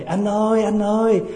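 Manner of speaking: wife jolted awake, calling out in mock panic; startled wife. Wife waking at night cries to husband, mock panic. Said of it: "Thì người vợ giật mình thức dậy".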